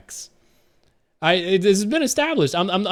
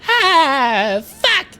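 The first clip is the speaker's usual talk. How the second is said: SpongeBob's squeaky curse, climbing much higher; SpongeBob cursing; 10 semitones higher, 5 dB louder than usual. Retold or imitated. SpongeBob swearing in the story, shrill, much higher squeak. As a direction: let it crack up into a squeak. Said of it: "SpongeBob's like".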